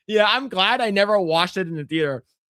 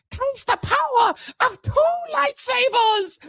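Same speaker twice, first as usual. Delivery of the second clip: mock villain boast. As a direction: shriek it triumphantly like a cartoon hero. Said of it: "she goes".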